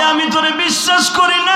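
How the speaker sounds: high, shouted refusal; mother's angry high shout. Acted. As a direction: yell it through the door as the mother. Mother refusing her son, shouted.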